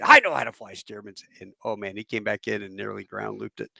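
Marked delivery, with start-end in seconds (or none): 0.0-1.2 louder, mock-irritated snap; pissed-off retort. Astronaut retorting to the plane's owner about flying it, pissed off. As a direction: imitate an offended veteran pilot cutting in. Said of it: "he got pissed".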